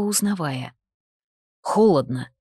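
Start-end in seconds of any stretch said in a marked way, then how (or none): 1.6-2.3 a hint of a lower male voice, louder; lightly voiced husband. A narrator's reading. Husband notices she is cold, lightly voiced lower. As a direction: say it suddenly, a touch lower. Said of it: "вымолвил внезапно".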